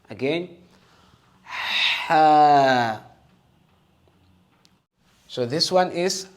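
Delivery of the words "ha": Ha pushed with cold air from the throat; breathy throat ha. Shown as a demonstration. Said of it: "The cold air from your throat".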